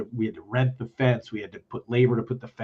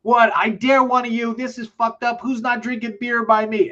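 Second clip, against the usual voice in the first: high, louder mock rant of the singer; 10 semitones higher, 4 dB louder than usual. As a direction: ham up the angry frontman, high-pitched. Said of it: "first thing out of [name]'s mouth is".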